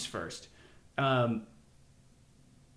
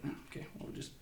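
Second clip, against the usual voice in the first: put-on unfazed kid voice; 7 semitones higher than usual. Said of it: "He was like".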